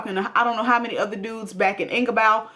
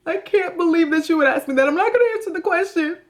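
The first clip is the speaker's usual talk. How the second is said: high, hammed-up mock sobbing; fake crying; 7 semitones higher than usual. Mocking a cheater who cries as a diversion, fake crying. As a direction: put on tearful outrage to dodge the question. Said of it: "Evelyn starts to cry, a diversion".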